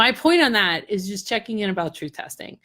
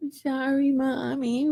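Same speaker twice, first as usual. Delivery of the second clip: higher, softer imitated child voice; apologetic kids; 4 semitones higher, 4 dB quieter, slower than usual. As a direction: mimic children sheepishly saying sorry to mom. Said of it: "he's got all the kids there".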